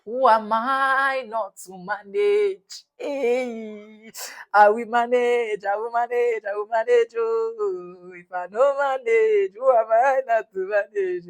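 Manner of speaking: loud, slow and emphatic, near tears; retold loud happy promise; overjoyed gushing. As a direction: gush with exaggerated gratitude. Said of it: "I was like".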